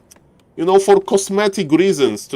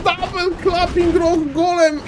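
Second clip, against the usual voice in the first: hyped caster; excited high call; 11 semitones higher than usual. Real, not acted. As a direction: call it in rising disbelief. Caster calling clap.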